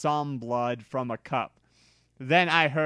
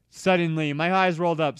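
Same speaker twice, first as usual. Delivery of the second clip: plain reading voice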